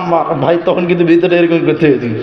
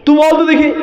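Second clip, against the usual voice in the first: daring challenge; 8 semitones higher than usual. Retold or imitated. Daring the boy.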